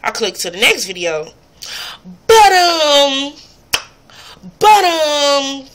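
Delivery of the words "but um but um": higher, louder mocking imitation of a girl stalling with "but, um"; imitating her "but, um". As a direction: mimic her hesitant filler, pitched up. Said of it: "she was about to smack her lips".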